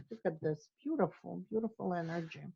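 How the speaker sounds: soft, quiet marveling at the colors; hushed admiration. Real, not acted. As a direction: breathe the words out, softly admiring. Admiring her own drawing.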